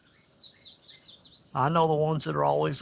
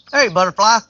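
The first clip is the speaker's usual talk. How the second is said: bright, louder greeting to a butterfly; louder, higher hello to a passing butterfly; calling to a butterfly; 4 semitones higher, 8 dB louder than usual. Real, not acted. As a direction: call out to it with delight. Calls out to butterfly.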